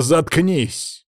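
high, sharp order; cutting interruption. A narrator's reading. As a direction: cut him off sharply. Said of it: "Оборвал я его".

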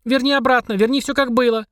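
put-on high, pleading kid voice; children demanding it back; upset child voice. Twins protest in high voices.

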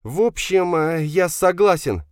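put-on high, hesitant young man's voice; tense son agreeing. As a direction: commit uneasily, pitched far up. Son agrees to secret deal. (высокий напряжённый голос сына)

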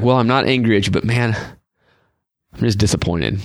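low, let-down parental sigh of a delivery; disappointed God. Acted. Imagining God letting someone down gently, mock disappointed. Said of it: "then he's disappointed".

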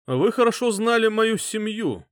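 smooth, persuasive, carrying voice; persuasive king. A narrator's reading. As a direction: speak smoothly to win the crowd over. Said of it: "сказал он".

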